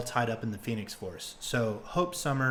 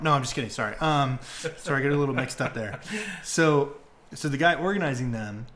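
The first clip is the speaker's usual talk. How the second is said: ordinary talk, nothing marked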